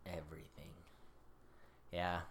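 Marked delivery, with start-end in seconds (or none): 0.1-0.7 whispered. Acted. Echoing the line quietly.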